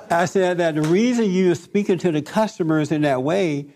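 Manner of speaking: ordinary delivery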